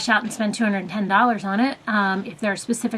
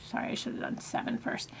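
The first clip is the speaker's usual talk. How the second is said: whispering; 11 dB quieter than usual. Real, not acted. Muttered apology to self.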